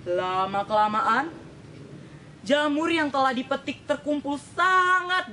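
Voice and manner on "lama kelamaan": usual speaking voice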